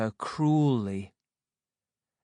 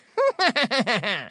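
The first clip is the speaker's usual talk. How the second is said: high, louder gloating cackle; 10 semitones higher, 4 dB louder than usual. A narrator's reading. Stepsisters cackle as they leave for the ball. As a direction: cackle with spite. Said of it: "they cackled".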